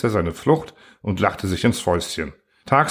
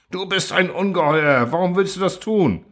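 higher, aghast accusation; outraged; 7 semitones higher than usual. Acted. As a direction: cry out in disbelief. Beaten Truhl accuses him.

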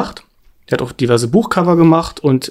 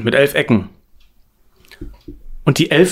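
normal talking voice

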